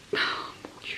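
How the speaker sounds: breathless awed whisper; hushed gasp of delight. Real, not acted. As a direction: whisper it in awe, barely voiced. Gasps at the bracelet while unboxing.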